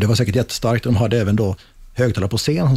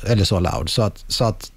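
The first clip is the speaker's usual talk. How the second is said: usual speaking voice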